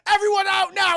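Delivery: high, loud shout to clear the room; shouted order. Retold or imitated. Film character ordering everyone out, shouted.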